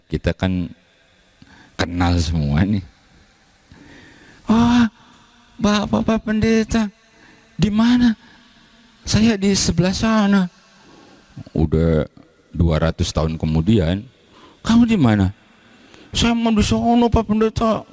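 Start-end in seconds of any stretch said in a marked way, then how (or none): 4.5-8.2 high, bright calls of joyful surprise in heaven; excited congregant. Acted. Congregant greets pastor in heaven. 9.1-10.5 high, bright calls of joyful surprise in heaven; excited congregant. Acted. Reply in heaven dialogue. 14.7-15.3 calling out. Acted. Pastor calls out in heaven. 16.1-17.9 high, bright calls of joyful surprise in heaven; excited congregant. Acted. Congregant answers pastor.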